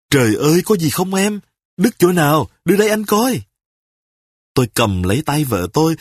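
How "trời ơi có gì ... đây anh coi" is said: flustered panic. Acted. A husband panics over his wife's cut finger. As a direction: fuss over her in a panic. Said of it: "tôi càng quýnh quáng".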